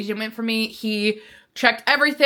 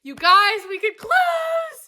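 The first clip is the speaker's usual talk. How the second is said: much higher, slow thrilled cry; excited shout; 13 semitones higher, much slower than usual. Real, not acted. Telling viewers she can finally close, overjoyed. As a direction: cry it out in pure joy.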